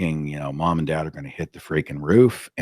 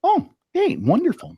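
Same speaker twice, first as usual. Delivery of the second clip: much higher, sing-song fake delight; feigned enthusiasm. Cousin unimpressed by his job answer, mock-gushing high. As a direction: gush politely but unconvinced. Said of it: "you could tell she".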